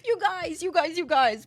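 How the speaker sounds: high, insistent calling for attention. Real, not acted. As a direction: call them over, rising pitch.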